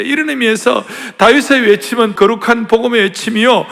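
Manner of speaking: usual speaking voice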